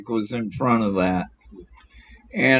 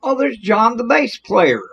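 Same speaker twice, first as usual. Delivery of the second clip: higher-pitched call of recognition; high, lively quoted hail; calling out a greeting; 9 semitones higher than usual. Retold or imitated. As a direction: act out someone spotting a friend. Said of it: "he looked up and he said".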